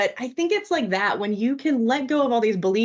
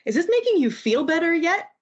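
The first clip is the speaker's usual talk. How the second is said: higher-pitched imitation of a little boy; child's earnest question; 8 semitones higher than usual. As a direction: ask it like a small child, pitched up. Retells four-year-old's earnest question.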